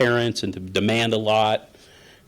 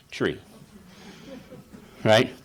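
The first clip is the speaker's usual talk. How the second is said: usual speaking voice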